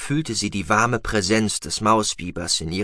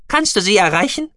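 high, loud creature voice; squeaky mouse-beaver voice; 12 semitones higher, 10 dB louder, faster than usual. Acted. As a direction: pipe it out eagerly. Gucki voiced.

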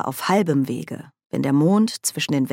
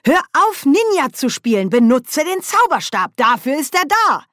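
much higher, louder frantic yell; shouting; 11 semitones higher, 5 dB louder than usual. Acted. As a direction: bellow at her to stop. Nanny yelling orders in a fight.

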